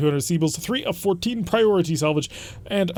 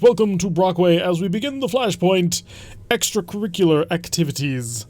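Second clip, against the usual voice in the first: ordinary talk, nothing marked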